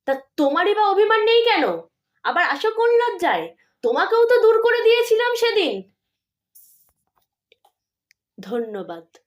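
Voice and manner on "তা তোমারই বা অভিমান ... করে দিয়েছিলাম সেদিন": much higher and louder fast tirade; furious shout. Acted. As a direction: drive her out with rage. Mother lashing out at daughter.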